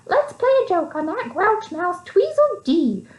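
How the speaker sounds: high, sly little mouse voice, slowing at the end; mischievous mouse. Acted. Mouse plotting a joke on Tweedledee, high and sly. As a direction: plot the prank with a grin.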